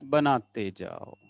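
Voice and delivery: low, slow singsong refrain; drawn-out coaxing. Real, not acted. Teacher coaxing students to keep drawing, low slow singsong. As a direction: draw the vowels out lazily, falling. Teacher chanting while class draws.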